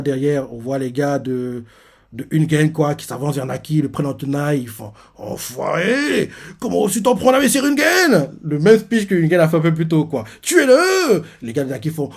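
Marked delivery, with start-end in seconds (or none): 5.2-11.2 insulte furieuse. Retold or imitated. Soldats furieux encerclent Naki.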